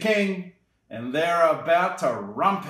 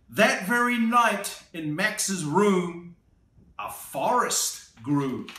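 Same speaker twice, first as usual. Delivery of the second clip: lively picture-book read-aloud; storybook narration for a little one; reading to children; 6 semitones higher than usual. A narrator's reading. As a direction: read it like bedtime story time.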